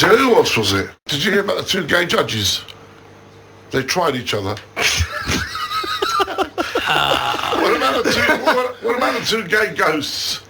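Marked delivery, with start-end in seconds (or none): 4.6-6.8 very high shrill whooping laugh; real high squealing cackle; high shrieking laugh. Real, not acted. Laughing at own joke. 6.9-10.4 giggling through the joke; real laughter running through the words; laughing. Real, not acted. Telling joke while laughing.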